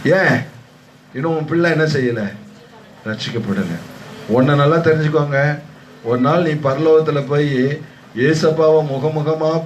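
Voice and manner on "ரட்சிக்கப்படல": ordinary talk, nothing marked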